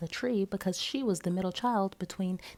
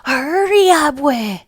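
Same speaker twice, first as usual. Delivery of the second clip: put-on high, loud eager child call; a boy shouting for his grandmother; excited call; 6 semitones higher, 13 dB louder, slower than usual. A narrator's reading.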